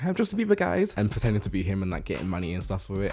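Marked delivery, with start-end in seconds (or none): 0.0-0.9 much higher showy announcement; mock pop star. Lookalike introducing himself at gigs, high and showy. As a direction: announce it like a fake star at a gig. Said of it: "being like".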